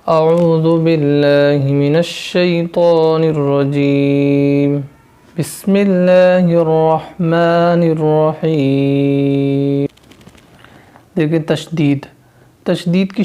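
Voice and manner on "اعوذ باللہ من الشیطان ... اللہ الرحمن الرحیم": slow, drawn-out recited Arabic; Quranic recitation. Acted. Teacher opening the lesson with slow recitation. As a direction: intone it like a reciter before class.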